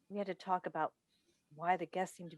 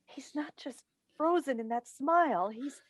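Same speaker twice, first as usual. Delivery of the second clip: breaking into tears; 7 semitones higher, 4 dB louder than usual. Acted. Mother sees son awake, weeps.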